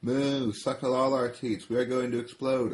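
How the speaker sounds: desperate cows pleading; high urgent cow voices. Acted. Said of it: "all the cows say".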